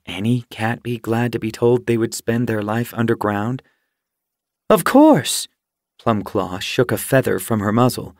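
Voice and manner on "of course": high, eager exclamation; excited. A narrator's reading. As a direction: burst out with delight. Tunneler answers eagerly.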